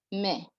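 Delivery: "me" as one vowel sound, like "meh"; vowels run together. Shown as a demonstration. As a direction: say "me" with A and I merged into one open E. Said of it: "M A I that says".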